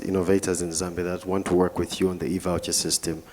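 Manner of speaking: usual speaking voice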